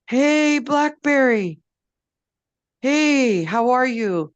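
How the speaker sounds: loud, high greeting; friendly call out. A narrator's reading. Wolf calling out to Blackberry, loud friendly hail. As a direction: call out warmly to the sulking tree.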